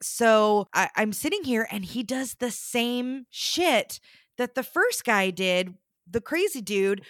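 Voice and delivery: normal talking voice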